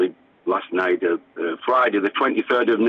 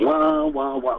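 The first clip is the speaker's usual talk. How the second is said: sung-out harmonica wail, pitched up; imitated harmonica; 4 semitones higher, slower than usual. Musician recalls the Beatles playing Love Me Do, imitating the harmonica. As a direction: wail "wah" like a harmonica riff. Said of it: "the harmonica and the".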